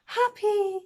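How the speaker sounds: put-on high, drawn-out toddler's sigh; imitating sleepy child's sigh. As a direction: mimic a contented child dropping off to sleep. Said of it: "flings his arms around my neck and sighs".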